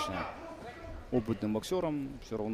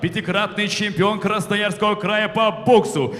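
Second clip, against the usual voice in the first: boxing ring announcer; 10 semitones higher, 10 dB louder, slower than usual. Acted. Announcer reading title.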